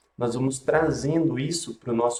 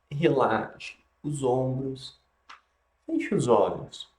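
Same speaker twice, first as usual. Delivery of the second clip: guided meditation; 3 dB quieter than usual. Acted.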